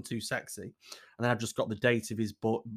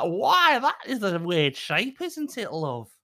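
a lightly voiced puzzled woman, much higher; mock puzzled woman; 8 semitones higher, slower than usual. Voicing the woman who found the chicken head, mildly puzzled. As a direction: play her as a bemused local, lightly. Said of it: "I was like".

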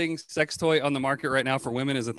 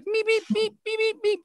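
Beaker meeps. Retold or imitated. Imitating Beaker's meeping.

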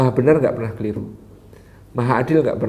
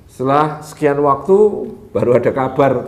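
ordinary talk, nothing marked

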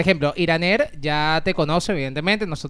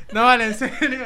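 laughing; 5 semitones higher than usual. Real, not acted. Host recovers from laughing.

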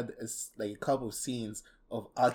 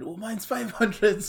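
higher, louder, slow smug boast; mock bragging; 10 semitones higher, 8 dB louder, slower than usual. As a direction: brag like a smug kid. Mocking Power's IQ bragging.

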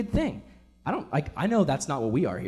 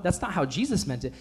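usual speaking voice

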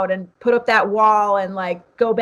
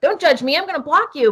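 higher, louder imitated huff; half-hearted mock outburst, higher pitched; mock defensive snap; 6 semitones higher, 3 dB louder than usual. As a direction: play a touchy poster firing back at critics. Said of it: "your knee-jerk reaction is to be like".

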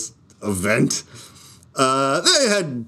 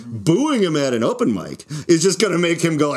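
usual speaking voice

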